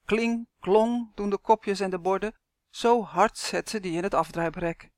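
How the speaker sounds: ordinary delivery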